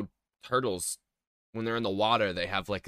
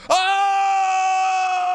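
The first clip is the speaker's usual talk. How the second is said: excited shout; 6 dB louder than usual. Real, not acted. Excited shout at the ketchup result.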